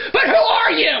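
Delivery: high, indignant burst; exasperated outburst. Acted. Cleaner bursting out again after saying sorry, exasperated outburst. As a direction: burst out in disbelief, pitch jumping up.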